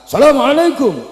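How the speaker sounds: high, loud salam. Shown as a demonstration. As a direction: call the greeting out high and hard. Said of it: "dengan nada tinggi, suara keras".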